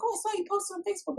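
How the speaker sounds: rattled off high and fast like a gossiping friend. Retold or imitated. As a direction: chirp it quickly up high.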